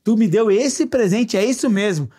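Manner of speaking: overjoyed friend stunned by the gift; delighted disbelief. Shown as a demonstration. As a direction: drag it out in thrilled disbelief. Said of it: "pela minha entonação de voz".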